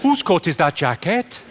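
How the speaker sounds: slow, puzzled Welsh lilt; confused Welsh accent. Shown as a demonstration. Comic showing the secret of the Welsh accent, slow confused Welsh voice. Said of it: "you've just got to sound confused".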